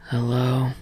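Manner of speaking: low, soft greeting; deep unhurried hello. A narrator's reading. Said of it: "a deep voice says".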